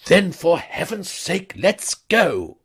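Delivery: high, loud, anxious toff voice; a rattled Wooster begging to leave; young toff in a hurry; flustered young gentleman. A narrator's reading.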